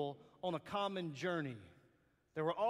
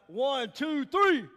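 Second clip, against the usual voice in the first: shouted count, climbing higher; counting down loud; 6 semitones higher, 10 dB louder, much slower than usual. Real, not acted. Preacher hyping the crowd, a rising shouted count. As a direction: count them in at full voice. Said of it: "everything you got".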